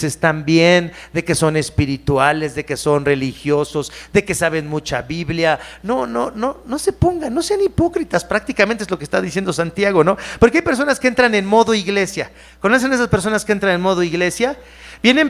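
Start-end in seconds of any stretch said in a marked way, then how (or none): none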